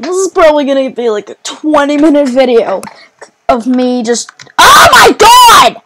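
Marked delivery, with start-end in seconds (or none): 4.6-5.8 loud, high, drawn-out yell; real shocked scream; startled shout. Real, not acted. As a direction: burst out mid-sentence in shock. Cut off by game shock.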